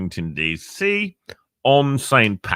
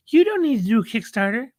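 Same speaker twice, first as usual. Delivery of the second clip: "You don't need to do a Kickstarter" much higher, quick; high voiced investor; 13 semitones higher, faster than usual. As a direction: toss it off in a high other voice.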